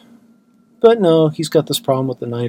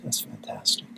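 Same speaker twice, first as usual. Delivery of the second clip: sarcastic, breathed out almost silently; deadpan whisper of mock praise; 9 dB quieter than usual. Real, not acted. Sarcasm after the loss.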